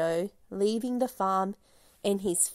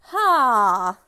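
ordinary delivery

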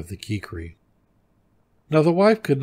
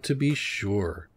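ordinary delivery, nothing marked

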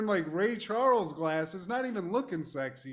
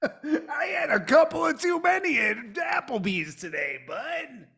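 laughing tipsy shout, voice pitched up; drunk Hawk; 10 semitones higher, 4 dB louder than usual. Acted. Answering were you drunk as tipsy Hawk, laughing shout. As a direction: answer like a loud, giggly drunk.

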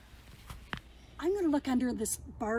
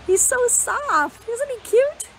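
cooing; 11 semitones higher, 7 dB louder, slower than usual. Real, not acted. Cooing over caterpillar for children.

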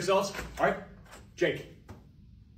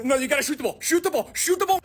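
rising, high-pitched frantic shout; mounting panic, higher each time; panicked urging; 9 semitones higher, 5 dB louder than usual. Acted. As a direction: urge yourself on, climbing into a yell. Player panics with the ball.

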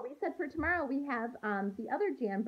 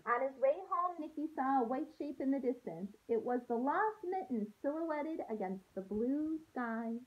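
storybook read-aloud voice for a class; gentle story narration to kids; reading to children; 4 semitones higher than usual. A narrator's reading. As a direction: read it like a teacher sharing a picture book. Teacher reads picture book aloud.

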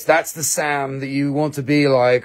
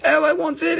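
eager buyer. Retold or imitated. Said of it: "somebody else says".

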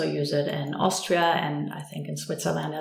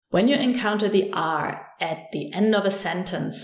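usual speaking voice

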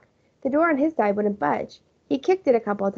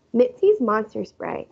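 ordinary delivery

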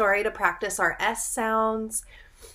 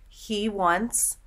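ordinary delivery

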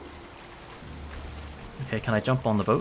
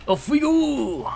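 high, long-drawn departure whoop; adventurous cry; 4 dB louder, much slower than usual. Pretend. Player sails off on adventure, high drawn-out cheer. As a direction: stretch it out like a hearty send-off.

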